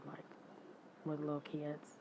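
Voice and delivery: normal talking voice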